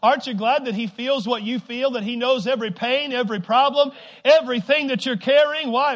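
loud, rousing sermon delivery; full-force preaching. Real, not acted. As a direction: preach it out to the whole congregation.

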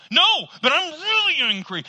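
high loud protest; angry insistence. Retold or imitated. Angry person answering "calm down" with a shout. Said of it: "Calm, calm down now".

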